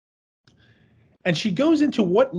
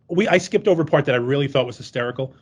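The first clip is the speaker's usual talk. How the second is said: ordinary talk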